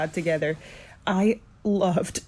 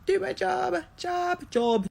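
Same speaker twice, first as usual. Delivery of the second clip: mock apology; 12 semitones higher than usual. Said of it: "And I'm like".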